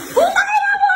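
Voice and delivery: high-pitched angry outburst; galit na galit. Real, not acted. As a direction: shout the curse, voice leaping up. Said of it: "Galit na galit ka na naman". (galit na sigaw, mataas ang boses)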